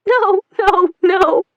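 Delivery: high, loud despairing no; wife moaning in despair at his confession; anguished moan. Acted. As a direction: cry it out in despair. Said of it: "Celine moaned".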